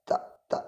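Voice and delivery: ta with a strong puff of air, almost unvoiced; aspirated ta. Shown as a demonstration. Teacher shows students ta must release air, soft and airy. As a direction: breathe out hard on each ta. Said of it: "harus mengeluarkan udara".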